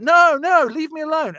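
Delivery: half-hammed frightened protest; mock pleading. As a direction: beg him to stop. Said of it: "she's like".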